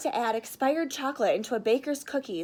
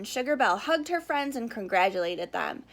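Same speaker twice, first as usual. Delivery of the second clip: plain reading voice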